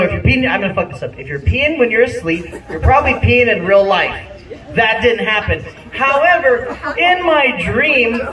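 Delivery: ordinary delivery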